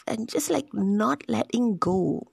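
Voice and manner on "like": ordinary talk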